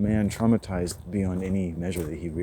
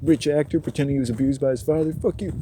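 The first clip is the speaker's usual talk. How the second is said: contemptuous rant; 7 semitones higher, 6 dB louder, faster than usual. Real, not acted. Ranting at actors.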